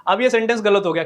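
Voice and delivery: usual speaking voice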